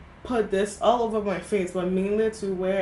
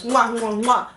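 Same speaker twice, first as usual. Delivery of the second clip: kiss sounds; 3 semitones higher, 6 dB louder than usual. Real, not acted. Blowing kisses.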